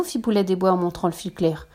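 ordinary delivery, nothing marked

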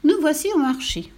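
high-pitched excited cow; mooed announcement. Acted. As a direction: cow calling out on arrival. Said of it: "meugla la vache".

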